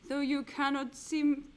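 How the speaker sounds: hammy portentous wizard. Pretend. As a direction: declaim the clue as a grand wizard. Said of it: "Shall I drop the wizard's voice".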